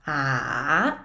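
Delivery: "a" dipping low, then swinging up; long dipping-then-rising "a"; roller coaster tone. Shown as a demonstration. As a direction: take "a" down and back up, drawn out. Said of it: "it goes down and up".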